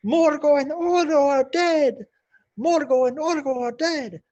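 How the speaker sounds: high, loud goblin cheering; a crowd of goblins celebrating the giants' deaths. Acted. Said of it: "they're, like, cheering".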